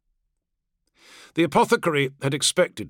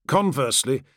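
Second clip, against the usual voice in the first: ordinary delivery, nothing marked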